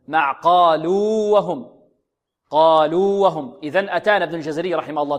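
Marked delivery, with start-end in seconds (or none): 0.1-3.6 قالوا وهم slowly, long "luu" then a clear "wa"; separated waw. Shown as a demonstration. Teacher demonstrating clear separation, separated waw. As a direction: stretch قالوا before starting وهم. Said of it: "أظهر".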